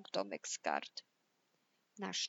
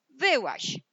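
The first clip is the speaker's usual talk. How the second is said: loud, raised-pitch wolf order; barked command; 5 semitones higher, 10 dB louder than usual. Acted. Wolf orders lazy pig out of the hut, loud command. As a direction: shout it as a sharp order. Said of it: "rozkazał leniwej śwince".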